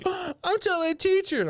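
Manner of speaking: high, slow tattletale voice; mocking girl's voice. The girl threatening to tell the teacher, high mocking tattletale. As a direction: mimic a snotty schoolgirl threatening.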